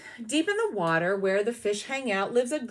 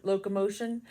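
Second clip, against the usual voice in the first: ordinary delivery, nothing marked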